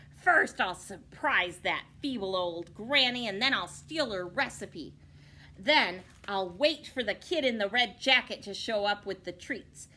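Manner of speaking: loud scheming wolf voice; gloating villain wolf. Acted. Wicked wolf gloating about his dastardly plan. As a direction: boast your plan like a cartoon villain.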